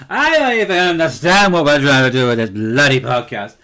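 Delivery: mock exasperated shout, loud and hammed-up; drunken rant. Podcaster imagining the tipsy end of the show, mock drunken rant. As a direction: holler it like a tipsy podcaster losing the plot. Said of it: "by the end of the podcast we're, like, going".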